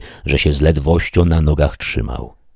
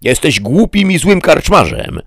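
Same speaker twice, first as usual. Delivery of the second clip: high-pitched, raised-voice insult; indignant shout; 12 semitones higher, 4 dB louder than usual. A narrator's reading. Knight insults the innkeeper before riding off, shouting indignantly. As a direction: cry out in outrage, pitch leaping right up. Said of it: "krzyknął Don Quixote".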